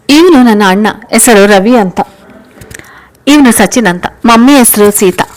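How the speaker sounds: lightly played child, projecting each introduction; lightly voiced chatty child. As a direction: introduce the family loudly, like a keen child.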